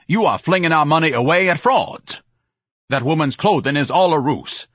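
loud, pompous indignation; indignant tirade. A narrator's reading. Son rants at his mother about frauds, loud indignant tirade. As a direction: scold her grandly for wasting money. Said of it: "Ignatius exploded".

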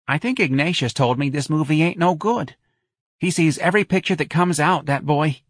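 a mother passing on her son's verdict; fretful Mrs Riley. Acted. Mrs Riley doubts the movie to friends, high and fretful.

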